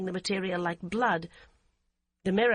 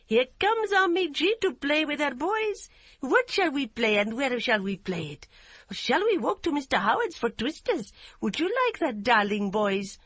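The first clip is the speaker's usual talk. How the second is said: high, louder playful coaxing; gaily; 6 semitones higher, 4 dB louder than usual. A narrator's reading. Yum luring her sons to play, gaily and high. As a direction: tempt the boys with a treat. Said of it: "Yum said gaily".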